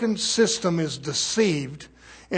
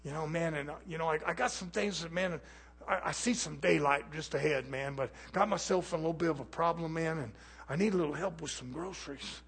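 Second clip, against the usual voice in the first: meek pleading beggar; 2 semitones lower, 13 dB quieter than usual. Retold or imitated. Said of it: "Somebody comes and says".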